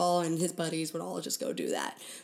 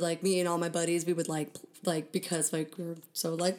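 ordinary talk, nothing marked

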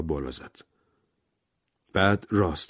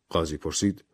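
ordinary delivery

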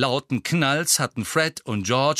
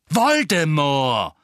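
slow, louder angry name; 5 semitones higher, 8 dB louder, much slower than usual. A narrator's reading. Harry angrily says the dreaded name to his friends. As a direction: drag the name out in anger. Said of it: "sagte Harry zornig".

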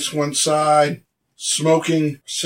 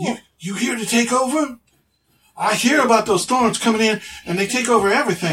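higher-pitched townsman, questioning; lightly voiced local passing on a rumour, pitched up; wary local; 7 semitones higher, faster than usual. Local asking strangers about a takeover, wary.

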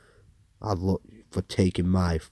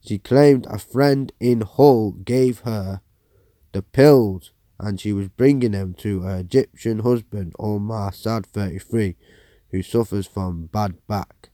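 loud, formal newsreader delivery; news read; 2 semitones higher, 6 dB louder than usual. Acted. Presenter reading a news report to viewers, formal newsreader voice. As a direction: read the headline story like an anchor.

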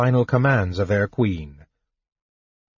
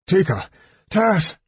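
high, gasping exclamations; gasped; 9 semitones higher than usual. A narrator's reading. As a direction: gasp out the names in sudden alarm. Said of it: "Caramon gasped".

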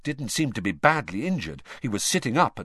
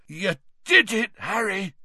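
hoarse; 9 semitones higher than usual. A narrator's reading. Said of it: "said Hagrid hoarsely".

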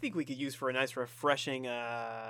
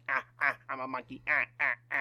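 monkey voice; 3 semitones lower, much faster than usual. Pretend. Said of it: "Monkey's like".

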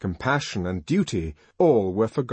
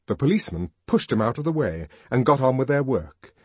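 plain reading voice

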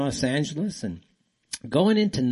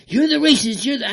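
much higher, strained mocking voice of a flustered opponent; flustered mock accusation; 11 semitones higher, 5 dB louder than usual. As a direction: toss the line back in a hammed-up, agitated voice. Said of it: "they get so flustered, like".

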